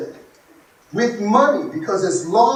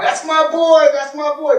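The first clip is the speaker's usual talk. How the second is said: put-on higher, louder boasting, stress on boy; proud dad; 8 semitones higher, 5 dB louder than usual. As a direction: crow with pride. Proud dad after touchdowns.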